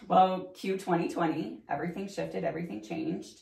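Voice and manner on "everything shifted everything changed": ordinary talk, nothing marked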